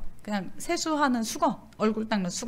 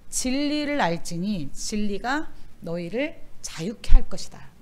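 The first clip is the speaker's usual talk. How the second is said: solemn scripture reading; 2 semitones lower, 4 dB louder, slower than usual. Real, not acted. Reciting scripture slowly.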